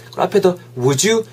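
ordinary talk, nothing marked

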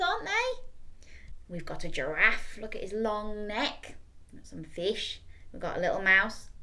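usual speaking voice

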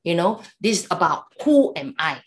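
normal talking voice

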